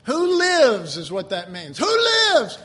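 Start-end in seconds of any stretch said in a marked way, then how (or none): 1.8-2.6 high, loud rallying question; yelled call. Retold or imitated. Preacher translating the Mexican call for listeners, yelled. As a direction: call it out to the whole church.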